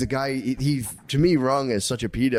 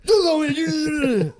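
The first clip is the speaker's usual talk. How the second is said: high, loud, slow whiny shout; mock yell; 4 dB louder, much slower than usual. Dad yelling back at his wife, a loud, slow, whiny wail. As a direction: wail it back at her slowly. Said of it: "I'm like".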